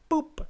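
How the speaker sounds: squeaky high boop; high boop sound effect. Retold or imitated. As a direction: chirp it like a cartoon effect.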